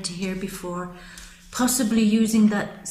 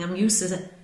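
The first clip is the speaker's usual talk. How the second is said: normal talking voice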